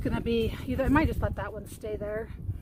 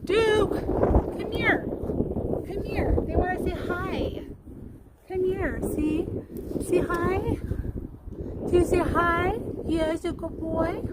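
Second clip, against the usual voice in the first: high-pitched voice for talking to a dog; coaxing dog talk; 6 semitones higher, much slower than usual. Real, not acted. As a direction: coax a pet over in a high, drawn-out lilt. Coaxing dog to greet viewers.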